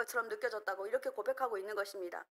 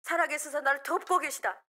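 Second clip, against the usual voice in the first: proclaiming shout; 3 semitones higher, 4 dB louder than usual. Shown as a demonstration. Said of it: "이렇게 외치시기 바랍니다".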